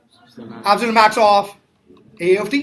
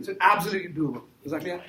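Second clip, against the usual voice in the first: ordinary talk, nothing marked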